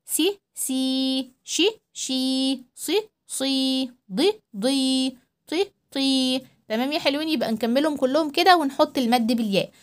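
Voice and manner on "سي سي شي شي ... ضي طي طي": each syllable held long; drawn-out سي، شي، صي with the long ee; stretched syllable drill. Shown as a demonstration. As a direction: hold every ee long. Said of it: "نكملها بنفس الطريقة".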